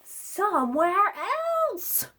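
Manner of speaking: loud, high, slow outburst; exasperated shout. Acted. Gerald answers Piggy, exasperated shout. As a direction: blurt it out fed up.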